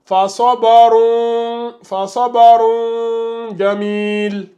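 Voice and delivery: "fa sabarun jamil" slowly, final n of sabarun held as a hum; hidden tanwin. Shown as a demonstration. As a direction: chant "fa sabarun jamil" with long drawn-out syllables. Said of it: "avec le tanwin".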